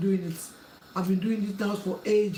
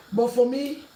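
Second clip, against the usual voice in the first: usual speaking voice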